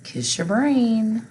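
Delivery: high, slow, bright praise to the class; cheerful praise. Real, not acted. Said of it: "Did you get it right?".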